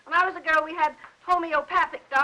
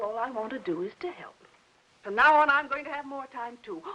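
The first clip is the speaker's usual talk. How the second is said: ordinary talk, nothing marked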